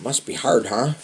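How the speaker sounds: ordinary delivery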